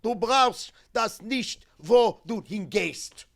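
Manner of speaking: loud, high, slow German command; harsh guard's voice. Retold or imitated. Nazi guard ripping a pin off a child, harsh. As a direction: imitate a guard's harsh order, drawn out and forceful. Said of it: "in a harsh voice he said".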